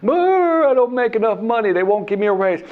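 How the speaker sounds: mock whine about life like a moaning uncle; whining relative. Relatives at Christmas complaining, high mocking whine. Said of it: "they're whining about".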